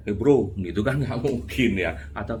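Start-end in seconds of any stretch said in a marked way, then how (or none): 0.1-0.5 a loud offhand "Hei Bro"; loud casual call. Pretend. Mocking a wrong way to invite the president, loud and casual. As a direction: call out to a buddy, too casual. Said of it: "Nggak mungkin kayak".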